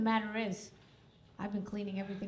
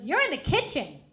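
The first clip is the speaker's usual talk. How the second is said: high, loud mock yell of a daughter; outraged retort; 9 semitones higher, 10 dB louder than usual. Daughter yelling back at mom after walking in, outraged retort. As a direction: yell it back, much higher and louder.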